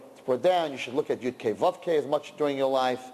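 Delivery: ordinary delivery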